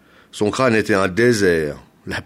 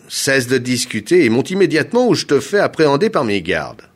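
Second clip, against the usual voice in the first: loud, raised, threatening command; angry order; 5 semitones higher, 5 dB louder than usual. A narrator's reading. Governor threatening arrest by his guards, angry and commanding. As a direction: order them to obey at once.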